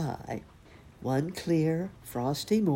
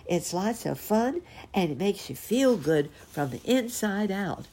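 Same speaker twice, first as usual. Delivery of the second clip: higher-pitched excited cub voice; 6 semitones higher than usual. A narrator's reading. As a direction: gush like an excited little bear cub. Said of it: "he asked them excitedly".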